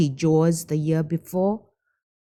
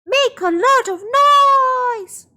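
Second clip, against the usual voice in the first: high, loud, slow call; May calling to the villagers, loud rallying shout; rallying yell; 6 dB louder, slower than usual. Acted. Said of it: "She yelled to the other villagers".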